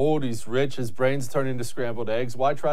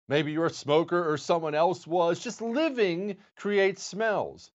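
ad read; 3 semitones higher, 4 dB quieter than usual. Acted. Host reads sponsor ad.

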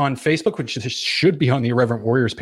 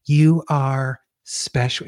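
slow, overblown inspirational ad read; mock commercial voice; much slower than usual. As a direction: ham up the heartfelt ad voice. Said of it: "they'll be like".

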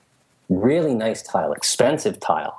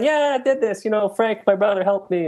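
much higher, showing off to friends; proud boast; 11 semitones higher than usual. Retold or imitated. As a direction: brag about the new bathroom. Bragging to admiring Christmas guests.

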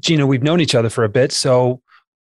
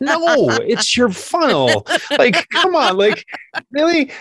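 mock exasperated reply; 12 semitones higher than usual. Answering a rep who blames his tone, mock exasperated.

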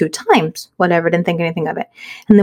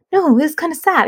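higher-pitched, mildly upset plea; retold tearful protest; 7 semitones higher than usual. Wife objects to husband at breakfast, mock tearful. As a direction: imitate yourself getting teary, pitched up. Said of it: "I'm, like, crying at the Denny's".

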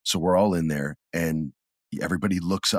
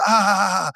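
crowd scream; 10 semitones higher than usual. Retold or imitated. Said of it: "And everyone goes".

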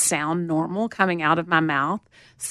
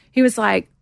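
normal talking voice

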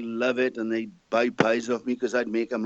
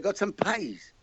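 higher Welsh "pies" with a strong long vowel; Welsh accent; 10 semitones higher, 5 dB quieter, slower than usual. Retold or imitated. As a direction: repeat it patiently in broad Welsh. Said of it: "The Welsh say pies".

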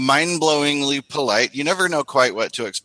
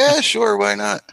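much higher, mock-casual yes; breezy agreement; 11 semitones higher, 3 dB louder than usual. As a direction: toss off an airy, high-pitched sure. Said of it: "I'm like".

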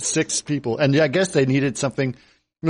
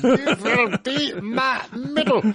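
put-on high, slow, laughing German-accented villain; mock German accent; 10 semitones higher, much slower than usual. Friend copies the villain's line in a laughing German accent. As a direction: drag it out like the film's mad doctor.

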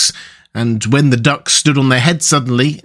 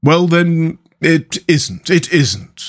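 placating mole, each phrase falling gently; mild, appeasing character voice; soothing; 4 semitones higher, much slower than usual. A narrator's reading. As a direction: give in soothingly to calm a cross friend. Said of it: "replied the mole soothingly".

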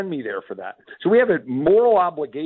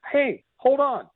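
higher, punchy "hey, hold on"; indignant pushback; 11 semitones higher, slower than usual. Retold or imitated. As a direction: cut him off, incredulous and higher. Retelling his own retort.

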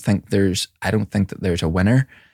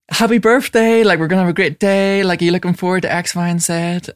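overexcited dad; 9 semitones higher than usual. Retold or imitated. Dad gushing at his uninterested daughter, high and eager. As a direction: gush at her with big energy.

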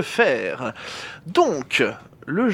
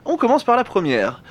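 ordinary talk

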